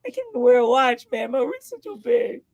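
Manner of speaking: quick, high comic wail; mock crying. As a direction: blubber it in a hammed-up high voice. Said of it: "He's crying".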